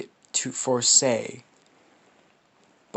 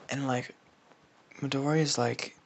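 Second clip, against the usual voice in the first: usual speaking voice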